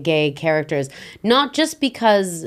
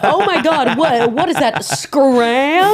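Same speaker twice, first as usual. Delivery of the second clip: higher, laughing mock American accent; fake American accent; 7 semitones higher, 4 dB louder, slower than usual. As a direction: ham up a foreigner's overdone American accent. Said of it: "they speak like this".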